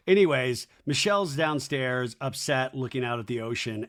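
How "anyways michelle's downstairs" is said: normal talking voice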